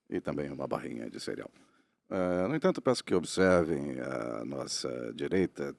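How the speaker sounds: low, slow airline captain announcement; lightly voiced cabin announcement; pilot over the radio. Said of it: "Ah, sou eu agora".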